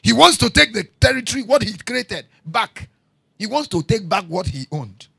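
loud, fast, driving pulpit voice; fiery sermon delivery; full-force preaching. Acted. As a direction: preach it hard at the congregation. Preacher building to a point.

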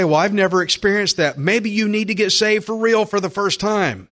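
usual speaking voice

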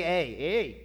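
put-on playful Canadian accent on "eh"; mock Canadian "eh". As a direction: tack on a Canadian-style questioning "eh". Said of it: "the way they say".

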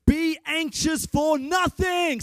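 loud, high, slow preached verse; preaching shout. Real, not acted. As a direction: proclaim it to the whole church. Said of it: "Say nothing".

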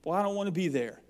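ordinary delivery

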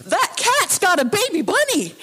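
high, loud, excited shout while running past; urgent call. Retold or imitated. Stranger chasing a cat calls to them, high excited shout. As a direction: blurt it out urgently as you run past. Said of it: "he turns to us and he says".

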